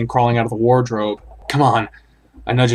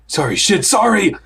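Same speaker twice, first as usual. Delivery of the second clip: panicked apology; 8 semitones higher than usual. Acted.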